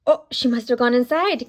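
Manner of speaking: ordinary delivery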